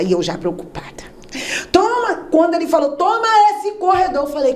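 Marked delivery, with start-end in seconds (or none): 1.7-4.2 preacher call. Retold or imitated. Missionary praying.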